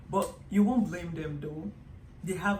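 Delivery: ordinary delivery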